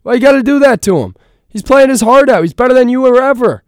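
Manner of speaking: loud, high, worked up; indignant rant. Real, not acted. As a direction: defend him loudly, exasperated. Heated rant defending player.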